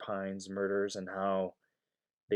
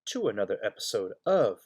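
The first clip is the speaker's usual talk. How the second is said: ordinary talk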